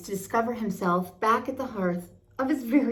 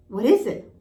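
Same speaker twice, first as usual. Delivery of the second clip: demanding; 3 semitones higher, 7 dB louder than usual. A narrator's reading. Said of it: "Piglet demanded".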